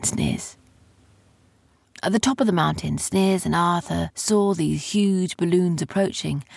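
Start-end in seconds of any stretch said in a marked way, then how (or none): none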